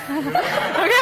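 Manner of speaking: a real high laugh breaking out. Real, not acted. Laughing at the demo.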